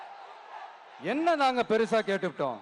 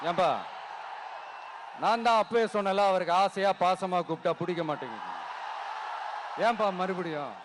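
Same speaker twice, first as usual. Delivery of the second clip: mock-weary aside; 4 semitones lower than usual. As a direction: drop out of oratory into a resigned aside. Mock-weary aside after the teasing call. (சலிப்பாக, தாழ்ந்த குரலில்)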